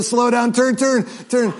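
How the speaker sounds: rising excitement at the game screen; urgent calling. Real, not acted. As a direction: call out to the driver to turn. Urging kid at driving game.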